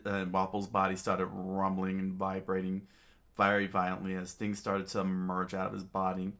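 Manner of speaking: usual speaking voice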